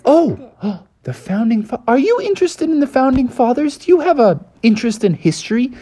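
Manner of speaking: high, fast and thrilled, gasping first; Froggy lights up at the mention of history: gasping, fast excitement; excited gasp. Acted.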